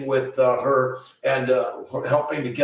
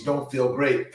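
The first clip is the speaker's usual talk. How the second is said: ordinary talk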